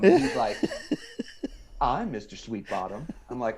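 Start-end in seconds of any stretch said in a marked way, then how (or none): 1.8-3.1 lightly put-on grating Southern drawl, low and soft. Man at the Patches building introducing himself to young recruit, Southern drawl. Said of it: "that Southern drawl accent".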